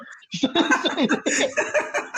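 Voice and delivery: bursts into a high laugh. Real, not acted. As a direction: laugh out at your own comeback. Laughing at his own retort.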